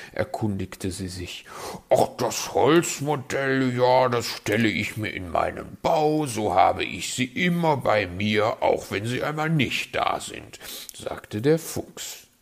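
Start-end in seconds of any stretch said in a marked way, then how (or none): 1.9-10.6 smooth, louder fox voice, dodging; sly fox. Acted. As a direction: spin a sweet excuse. Fox explains sweetly.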